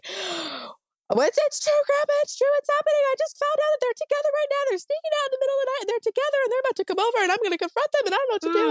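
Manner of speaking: high, fast, frantic panicked pleading; hysterical retelling. Retold or imitated. Wife panicking to grandma on phone, high frantic rush. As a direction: reenact the panicked call, breathless and shrill-high. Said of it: "I was hysterical, screaming".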